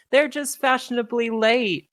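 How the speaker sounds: high, defensive heckle; crowd member. Acted. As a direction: stick up for the late group. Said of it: "One person says".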